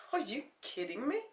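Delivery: loud shocked disbelief; disbelieving. Acted.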